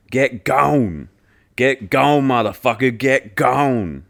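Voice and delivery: loud mock rap chant; yelled chorus. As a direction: yell it like a crowd chant. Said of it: "yell along to".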